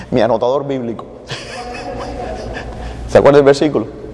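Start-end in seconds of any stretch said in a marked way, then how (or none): 1.1-3.0 breathy burst of real laughter; risa suelta. Real, not acted. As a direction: laugh at your own quip about the Bible. Laughs at his own joke. (risa espontánea entre dientes)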